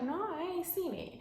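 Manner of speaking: high, innocent-sounding lie; innocent denial. Acted. Ruth with food on her shirt, high innocent denial. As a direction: play dumb, voice up high. Ruth lying about macaroni.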